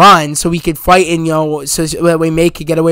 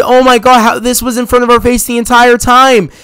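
higher-pitched excited realization; flipping out; 4 semitones higher than usual. Retold or imitated. Viewer reliving the twist about Aunt May, flipping out. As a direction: relive the stunned revelation with rising energy. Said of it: "I was flipping out".

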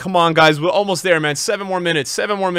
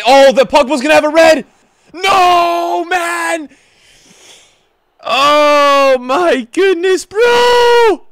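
higher and louder, slow stretched "goodness"; anguished shout; 10 semitones higher, 7 dB louder, much slower than usual. Real, not acted. Gamer yelling at a red card in his match, anguished shout.

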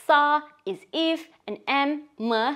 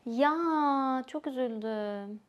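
sad; 6 dB quieter than usual. Shown as a demonstration. Hearing a friend's dog died, softly sad. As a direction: sigh out the ya in sorrow, much softer. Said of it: "show that you are really sad".

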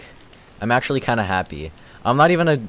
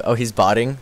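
ordinary talk

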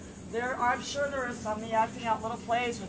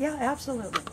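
normal talking voice

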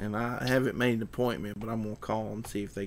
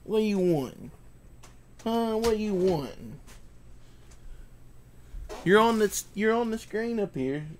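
higher, sing-song pet talk; talking to the dog; 9 semitones higher than usual. Real, not acted. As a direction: coax the dog in a high voice.

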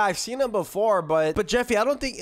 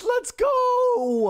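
high cheering shout; 12 semitones higher than usual. Acted. Dad cheering boss kill.

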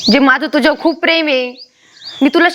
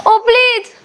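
desperate plea; 7 semitones higher, much slower than usual. Acted. Wife begs husband not to leave.

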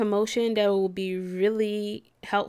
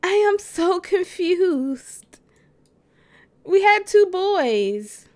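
high, slow, louder exclaiming; excited confusion; 7 semitones higher, 6 dB louder, slower than usual. Real, not acted. Gamer telling viewers about the twin boys, overwhelmed. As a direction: stretch it out, overwhelmed.

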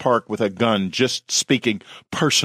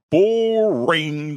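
high, drawn-out jeer; yelled heckle; 7 semitones higher than usual. A narrator's reading. Emperor heckles the fighting werewolves, yelling high. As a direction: yell it out like a bored heckler. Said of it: "the Qianlong Emperor yelled".

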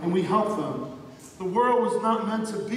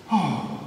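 heavy, lower-pitched sigh; 4 semitones lower than usual. Retold or imitated. Preacher acting out Jesus before praying, sighing. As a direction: let out a long sigh. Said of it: "it says he sighed".

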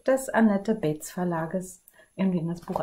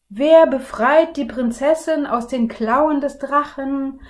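higher, louder proclamation; king's call; 6 semitones higher, 8 dB louder than usual. Acted. King calling to the princes from the balcony, loud. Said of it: "rief der König mit bebender Stimme".

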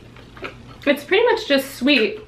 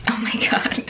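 whisper; 5 dB quieter than usual. Real, not acted. Shocked aside after grease spill.

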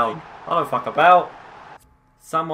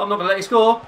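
raised, high defiant call; tense defiance; 8 semitones higher, 6 dB louder, much faster than usual. Real, not acted. Player defending against an opponent, tense defiant call. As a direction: warn the attacker off, high and loud.

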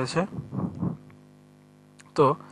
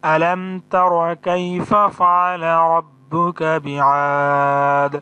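slow recitation with বিআদ held long, three beats; 2 semitones higher, 6 dB louder, much slower than usual. Shown as a demonstration. Teacher showing the long three-beat madd to students. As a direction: chant the verse and stretch the আ in বিআদ. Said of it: "ওয়াকফ হওয়ার কারণে তিন আলিফ টান দিতে হবে".

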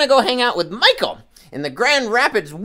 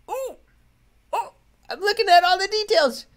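puppet voice; 10 semitones higher than usual. Pretend. Puppet Peanut admires picture.

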